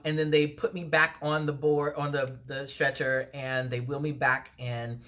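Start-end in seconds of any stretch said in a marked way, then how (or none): none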